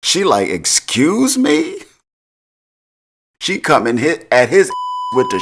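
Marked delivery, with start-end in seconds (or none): 0.5-1.8 a drawn-out, much higher "Excuse me?" rising in mock outrage; indignant excuse me. Noma, affronted by Kane's mockery, asks in high, slow mock outrage. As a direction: stretch it high and slow, offended.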